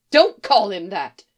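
loud, indignant reproach; sharp objection. A narrator's reading. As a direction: cut in loudly to object. Mother objects to son's other name.

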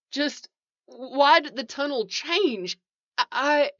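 high, halting, stammering fear; panicked. A narrator's reading. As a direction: falter as panic takes over. Trapped man stammers in panic.

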